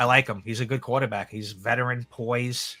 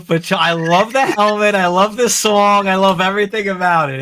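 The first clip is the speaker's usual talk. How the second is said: higher and louder, bubbling over with delight; gushing; 8 semitones higher, 8 dB louder than usual. Real, not acted. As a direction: rave about the helmet like a fan. Gushing over the Chargers helmet.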